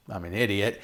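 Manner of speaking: usual speaking voice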